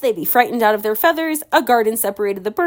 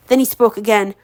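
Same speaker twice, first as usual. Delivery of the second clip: ordinary delivery, nothing marked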